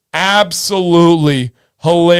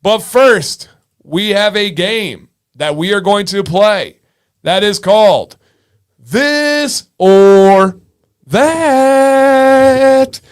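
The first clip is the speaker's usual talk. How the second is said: big, drawn-out title reveal, louder and higher; game show announcer; 3 semitones higher, 4 dB louder, slower than usual. Real, not acted. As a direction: build up to the game's title like a host. Announces the game's name like host.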